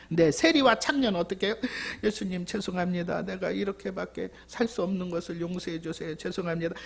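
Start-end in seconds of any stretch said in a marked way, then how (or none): 2.0-6.7 soft, quiet, sorry confession; remorseful plea. Retold or imitated. Sinner confessing to Jesus, quiet and remorseful. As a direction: murmur an apology, ashamed. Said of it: "너무 부끄럽고 죄송해서 막 예수님한테 애통을 하는 거예요".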